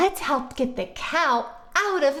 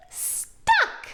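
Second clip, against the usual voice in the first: much higher, unvoiced squeak; squeaky stuck. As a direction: squeak it like a tiny stuck animal. Character squeak in kids show.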